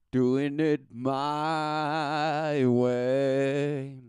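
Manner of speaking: high, slow and sneering; mocking. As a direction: drag out my way. Mocking their boast.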